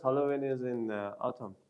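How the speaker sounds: normal talking voice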